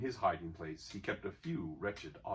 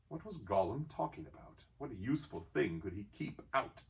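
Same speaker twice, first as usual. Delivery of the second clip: ordinary delivery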